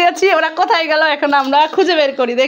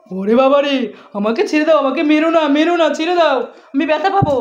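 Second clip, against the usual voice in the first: lower, hammed-up begging; mock pleading; 5 semitones lower than usual. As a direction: beg mercy in mock fright. Caught intruder begging not hit.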